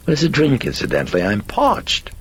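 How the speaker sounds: high, loud chirp with a casual dip; breezy demanding. Acted. Possessing personality asks priest for a drink, high and breezy. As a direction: toss out the demand airily, pitched high.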